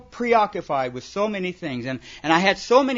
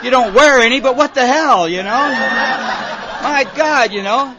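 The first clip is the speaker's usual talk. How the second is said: put-on drunk flattering himself; 4 semitones higher, 5 dB louder than usual. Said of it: "and he goes".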